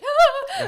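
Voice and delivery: very high, loud scream of fright; sudden piercing scream, played for laughs; mock scream. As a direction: shriek as if a monster jumped out. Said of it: "That's just screaming".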